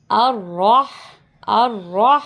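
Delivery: الرح with a heavy, rounded R; full-mouthed rolled ra in الرح, breathy H after; thick R. Shown as a demonstration. Teacher showing students a thick ra in الرح. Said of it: "را کو موٹا پڑھیں گے".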